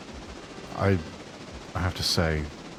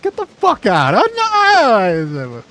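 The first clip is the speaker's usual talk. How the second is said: high, loud shriek of disbelief; shocked outburst; 9 dB louder, much slower than usual. Retold or imitated. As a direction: yelp it out in shock. Retelling a movie scene reaction.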